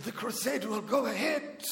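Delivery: imitated German preacher accent. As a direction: put on Reinhard's German accent. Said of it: "in his German accent".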